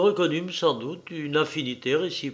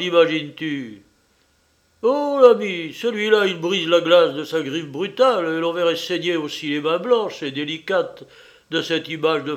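loud, raised-pitch angry exclamations; furious outburst; 4 semitones higher, 6 dB louder than usual. A narrator's reading. The little one rages at the narrator over a stolen reflection, furious. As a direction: cry out in indignant fury at the absurdity. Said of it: "bondit en l'air avec fureur".

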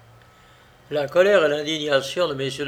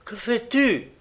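higher, alarmed cry; a touch of frightened urgency; cry of terror; 7 semitones higher than usual. A narrator's reading. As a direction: stop him in horror. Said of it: "un cri de terreur".

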